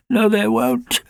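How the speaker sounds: high, tearful puppy protest; mock-sob it like a frightened puppy; lightly acted sob; sobbing. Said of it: "sobbed Alfie".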